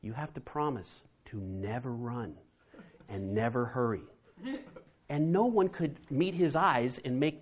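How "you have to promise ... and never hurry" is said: serious. Retold or imitated. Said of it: "with this kind of very serious look and say".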